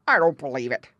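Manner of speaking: loud, gruff disbelief; gruff old frog. A narrator's reading. Grandfather Frog refusing to believe the muskrat, gruff. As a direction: croak a firm dismissal.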